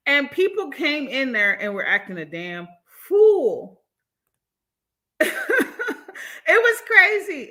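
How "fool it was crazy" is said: laughing. Real, not acted. Laughing at absurd commenters.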